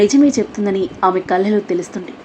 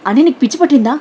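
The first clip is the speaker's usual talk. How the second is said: angry yell; 4 semitones higher, 5 dB louder, faster than usual. A narrator's reading. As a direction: shout it higher and louder, furious. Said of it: "కోపంగా అరుస్తూ".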